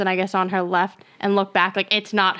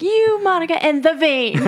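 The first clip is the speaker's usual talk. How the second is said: raised, high-pitched comic indignation; mock accusing; 8 semitones higher, 3 dB louder than usual. Acting out an indignant Friends scene for the co-hosts, mock accusing. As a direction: point the finger in a high, dramatic retelling.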